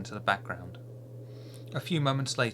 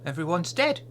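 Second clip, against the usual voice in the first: put-on child voice; 8 semitones higher, 5 dB louder than usual. Child voice continues.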